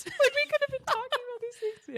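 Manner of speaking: high-pitched laughing lament; laughing dismay. Retold or imitated. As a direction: wail it through a laugh.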